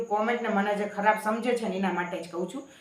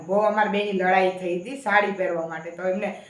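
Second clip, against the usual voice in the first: ordinary talk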